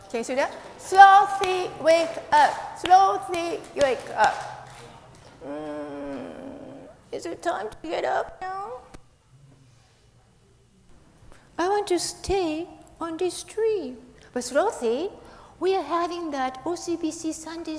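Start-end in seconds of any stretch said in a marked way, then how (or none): 0.8-4.7 loud wake-up call, higher. Acted. Calling puppet to wake up. 5.5-14.0 higher sleepy puppet voice, groggy; a just-woken sloth who won't budge. Acted.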